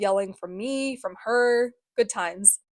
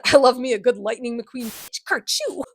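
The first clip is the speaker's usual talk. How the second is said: mock sassy author voice; 3 semitones higher, 5 dB louder than usual. Joke voicing of the author.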